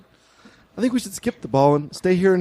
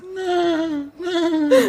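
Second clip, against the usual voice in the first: mock dramatic wail; 14 semitones higher than usual. Improv scene, mother's mock horror.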